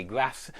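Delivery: grass with a flat a; short American a in grass. Shown as a demonstration. Teacher quoting American grass. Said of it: "in the States, certainly they say".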